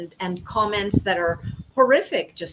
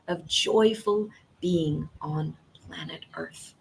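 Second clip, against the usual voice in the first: whisper; 3 semitones lower, 4 dB quieter than usual. Real, not acted. Whispered line in a pep talk.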